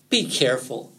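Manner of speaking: mild cautionary tone; understated fox's warning. A narrator's reading. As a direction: warn the rabbit, pitch lifting. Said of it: "warned Fox".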